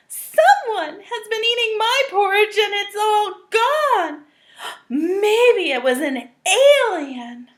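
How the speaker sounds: excited small cub, gasping; Baby Bear voice. Acted.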